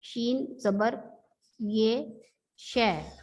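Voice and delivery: शय drawn out very slowly; teacher modelling शय slowly; slow pronunciation demo. Shown as a demonstration. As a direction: stretch शय out syllable by syllable for pupils. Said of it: "शीन के ऊपर जबर लगाने से हम इस लफ्ज को पढ़ेंगे".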